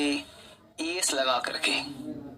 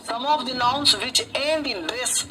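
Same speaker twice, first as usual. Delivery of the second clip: slow dictation; 4 semitones higher, much slower than usual. Shown as a demonstration. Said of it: "teacher dictating slowly".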